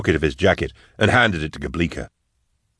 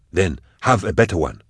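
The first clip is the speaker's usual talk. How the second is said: plain reading voice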